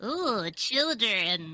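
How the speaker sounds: slow, drawn-out witch voice; Winifred voice. Acted. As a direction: savor the word like a hungry witch. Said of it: "I'll be Winifred".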